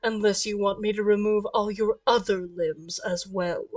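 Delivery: low, soft, controlled threat; quiet menace. Acted. Omen orders wings removed, quiet menace. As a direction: threaten without raising your voice.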